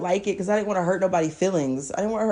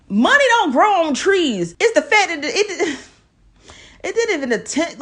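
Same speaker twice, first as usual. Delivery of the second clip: heated; 8 semitones higher, 5 dB louder than usual. Real, not acted. Angry rant about the money.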